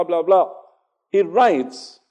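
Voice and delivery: normal talking voice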